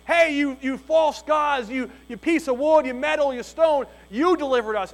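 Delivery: loud mock praise to false gods; Israelites praising idols. Preacher voicing Israelites praising idols, loud mock praise. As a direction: call out to the idols in mock devotion. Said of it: "Israelites are saying".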